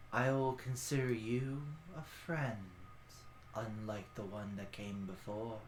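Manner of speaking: gentle, grave and measured; hushed ancient guardian; ancient creature voice. Acted.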